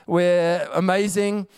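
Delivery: high, triumphant mock demon crowd; gloating demons. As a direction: crow over the victory like cocky demons. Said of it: "They were like".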